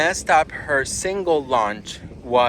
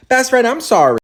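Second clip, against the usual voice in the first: sassy apology; 7 semitones higher, 6 dB louder than usual. Real, not acted. Mock apology after chart flop.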